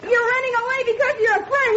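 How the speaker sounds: high, rising accusing outburst; angry accusation. Acted. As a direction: hurl the accusation at him. Son accuses father in quarrel.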